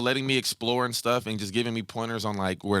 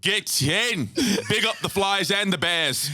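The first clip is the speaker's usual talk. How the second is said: high, slow, laughing British shout; British accent; 9 semitones higher, slower than usual. Shown as a demonstration. Cheering on the Flyers and Bears, in a British accent. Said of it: "That is literally, like, perfect".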